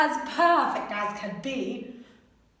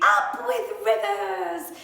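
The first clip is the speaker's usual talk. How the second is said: hooted, higher happy slogan; hooting duckbills; 5 semitones higher, 4 dB louder than usual. A narrator's reading. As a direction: hoot it with delight. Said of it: "They hooted".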